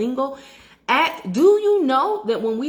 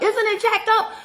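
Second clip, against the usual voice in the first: indignant high question; 11 semitones higher, 3 dB louder than usual. Real, not acted. To viewers about the agent, high indignant disbelief. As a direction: pitch jumps way up in disbelief.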